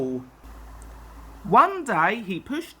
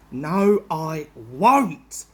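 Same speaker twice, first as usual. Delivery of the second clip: cross; 3 semitones lower, 4 dB louder, much slower than usual. Acted. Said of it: "said the barber crossly".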